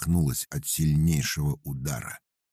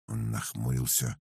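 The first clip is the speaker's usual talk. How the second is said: ordinary delivery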